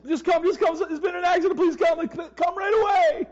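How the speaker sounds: high, loud frantic begging; urgent cry for the pastor to hurry; panicked pleading. Retold or imitated. Clay on the phone begging his pastor to come, frantic high plea.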